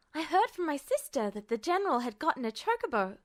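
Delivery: piping up. A narrator's reading. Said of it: "Someone piped up suddenly".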